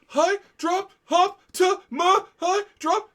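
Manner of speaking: high, slow, loud crying wail; mock wailing. Fan retells wailing over the dropped box, mock crying. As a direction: wail it like a broken-hearted child. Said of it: "I'm like".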